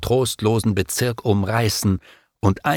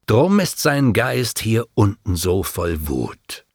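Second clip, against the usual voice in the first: ordinary delivery, nothing marked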